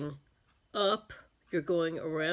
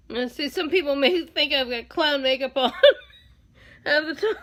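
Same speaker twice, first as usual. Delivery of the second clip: higher, giggling through the words; cracking herself up; 5 semitones higher, 5 dB louder than usual. Real, not acted. Makeup artist teasing herself about clown makeup, laughing as she talks. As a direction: talk on while laughter keeps breaking in. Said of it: "I start cracking myself up".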